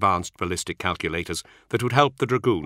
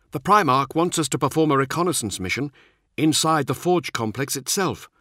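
higher-pitched squad commander's voice; brief the men in a raised character voice; narrator's higher voice for an officer; Nemiel; 7 semitones higher than usual. Acted.

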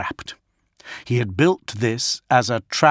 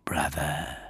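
breathy whispered taunt; villain whispering to his brother; 8 semitones lower, 9 dB quieter than usual. A narrator's reading. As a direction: drop to a menacing whisper.

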